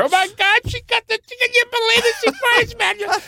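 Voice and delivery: high, gleeful mock audience chatter; hysterical audience. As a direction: squeal like a laughing crowd.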